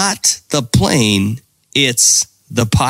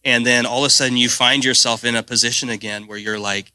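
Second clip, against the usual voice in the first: usual speaking voice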